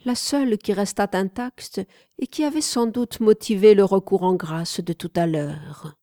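plain reading voice

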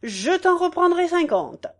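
high, ringing proclamation; lofty campaign promise; candidate's grand promise. Acted. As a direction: declaim it grandly like an orator.

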